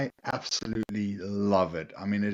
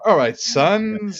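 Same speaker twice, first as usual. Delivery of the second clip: slow, high, loud northern English greeting; slow hammed-up northern English accent; 12 semitones higher, 6 dB louder, much slower than usual. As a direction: drawl it like a Yorkshireman. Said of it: "they're from England".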